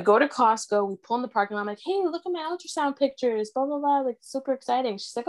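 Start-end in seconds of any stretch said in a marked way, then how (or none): 1.8-5.0 mock-excited, higher voice; retold excitement. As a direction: lightly act out showing off big news. Excitedly showing her mother pictures.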